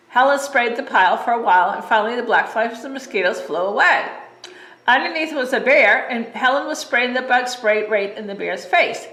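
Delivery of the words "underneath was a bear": usual speaking voice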